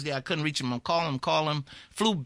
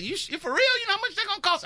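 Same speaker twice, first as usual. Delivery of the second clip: incredulous pilot; much faster than usual. Retold or imitated. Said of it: "and he said".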